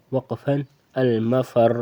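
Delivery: المفر stopped on a heavy ra, no vowel; pausal form. Shown as a demonstration. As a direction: end المفر on a still, thick ra. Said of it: "آخر میں جب ہم وقف کریں گے". (وقف پر المفر، را ساکن اور موٹا)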